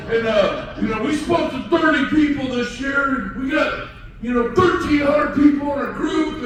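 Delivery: loud, high, slow hammed-up bragging wife; mocking the peppy wife. Speaker mocking a bragging wife to agents, high and loud. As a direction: ham up the chirpy retiree couple pitch. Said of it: "they get up there and they're like".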